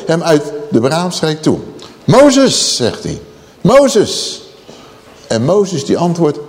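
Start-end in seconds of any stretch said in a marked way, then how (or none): 2.0-2.8 high, loud call of the name; a divine call, raised and high; God calling out. Acted. God calls out to Moses. 3.6-4.4 repeated divine call; God calling again. Acted. God calls out to Moses.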